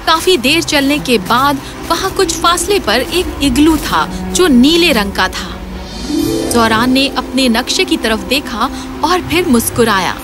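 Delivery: plain reading voice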